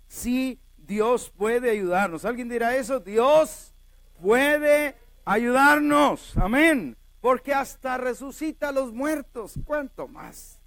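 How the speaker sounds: loud, high, drawn-out proclamation; ringing sermon climax; full-force preaching. Real, not acted. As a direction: proclaim it to the congregation, stretching key words.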